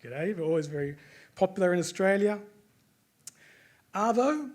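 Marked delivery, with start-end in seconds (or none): 3.9-4.5 "Arvo" said as a single Aussie word, pitched higher; Aussie slang word. Shown as a demonstration. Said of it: "is short for afternoon".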